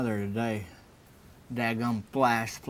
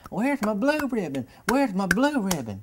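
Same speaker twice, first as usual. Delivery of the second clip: put-on much higher and louder, an old man demanding a prize; excited old man; 11 semitones higher, 8 dB louder than usual. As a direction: demand the ribbon high and loud like a giddy old man. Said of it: "And he starts clapping".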